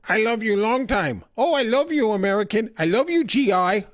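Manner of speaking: high-pitched mock come-on; women in war movies. Brothel women calling to soldiers in a war movie, mock fawning. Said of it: "all the women are going".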